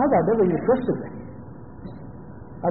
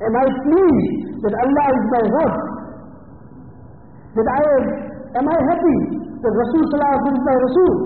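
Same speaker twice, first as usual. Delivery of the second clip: earnest preaching; 3 semitones higher than usual. Acted. Slow rhetorical questions to congregation.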